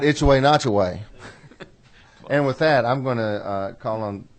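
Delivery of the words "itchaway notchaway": "Itchaway, Notchaway" tossed off high, fast and loud in a South Georgia lilt; playful South Georgia accent with a laugh. Retold or imitated. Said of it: "that South Georgia accent on that word".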